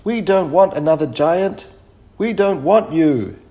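loud, high villagers' protest; yelled. A narrator's reading. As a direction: shout it as a hostile crowd. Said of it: "they yelled".

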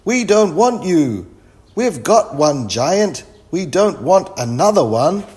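high, loud crowd protest; yelled. A narrator's reading. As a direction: yell it out as angry villagers. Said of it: "yelled the people".

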